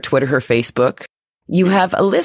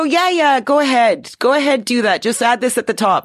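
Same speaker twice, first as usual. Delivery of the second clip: high breezy go-ahead; casual lawyer voice; 7 semitones higher than usual. Retold or imitated. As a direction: toss it off like an easygoing lawyer. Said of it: "the lawyers go".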